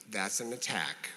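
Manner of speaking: low, soft, composed; name it very calmly, voice dropped. Shown as a demonstration. Answering someone's non-apology, very calm. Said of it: "just calmly say".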